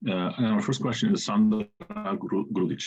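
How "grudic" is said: ordinary talk, nothing marked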